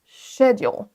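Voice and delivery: British SCHEDULE, stress on SHED; première syllabe accentuée. Shown as a demonstration. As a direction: hit the first syllable of SCHEDULE, SH onset. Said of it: "la première syllabe qui est accentuée". (SCHEDULE accentué sur CHED)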